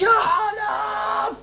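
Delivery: high, slow drawn-out shout; mock yell. Son imitating father calling for help, mock drawn yell. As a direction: stretch the cry out. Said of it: "وصرت أتصرخ".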